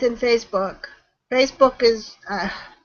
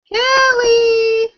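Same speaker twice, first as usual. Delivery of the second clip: high, loud call of her name; real calling out; 9 semitones higher, 4 dB louder than usual. Real, not acted. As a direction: call her back to the screen. Calling to frozen Kelly.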